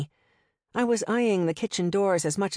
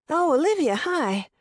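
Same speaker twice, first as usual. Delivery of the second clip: high, jumpy hello; startled greeting; 9 semitones higher, 3 dB louder than usual. A narrator's reading. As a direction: caught off guard, then recovers. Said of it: "looked faintly surprised".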